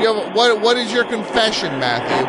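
loud, high, startled question; stunned demand; shocked. Acted.

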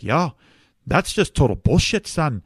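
imitating a blunt Irish guy putting him in his place; Irish accent. Irish man at an AA meeting scolding the speaker, in an Irish accent. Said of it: "just straight up in an Irish accent".

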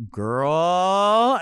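loud, long rising exclamation; exaggerated girlfriend gasp of a word; mock scandalized. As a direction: stretch it out like juicy gossip. Fake gossip to escape chat.

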